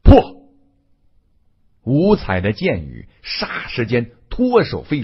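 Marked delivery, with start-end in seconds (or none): 0.0-0.5 explosive one-word battle cry; forceful incantation; great shout. A narrator's reading. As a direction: unleash the spell with a sudden loud shout. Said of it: "大喝道".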